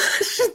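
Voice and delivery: a quick high giggle; giggle, amused. Real, not acted. Mother in the car with daughter, high giggle. Laughing at her own idea.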